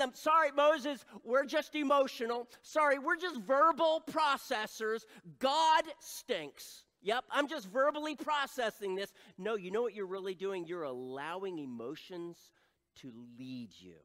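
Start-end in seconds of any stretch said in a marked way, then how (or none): 0.1-9.1 loud, high mock excuse-making; hammed-up, high-pitched protest; mock complaining. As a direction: complain loudly like a defiant crowd. Acting out complaining Israelites.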